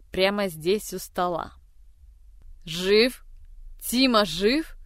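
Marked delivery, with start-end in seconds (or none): 2.6-4.7 loud, stunned cry of joy; exclaiming. Acted. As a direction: burst out in disbelief. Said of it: "это воскликнула".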